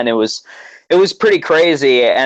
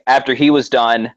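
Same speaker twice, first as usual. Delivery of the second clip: normal talking voice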